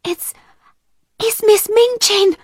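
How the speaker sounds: high, halting, alarmed voice; frightened whisper. A narrator's reading. Becky warning the girls who is coming, frightened. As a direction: stammer it out in high-pitched alarm. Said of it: "whispered Becky".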